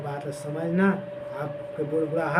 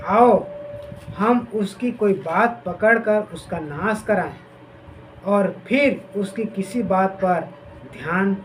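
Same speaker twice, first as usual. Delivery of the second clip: loud, slowed dramatic reading of the plotters; scripture reading; 2 semitones higher, 3 dB louder than usual. Acted. Preacher reads Jeremiah's enemies plotting, loud and dramatic. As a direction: read the conspirators' words with force.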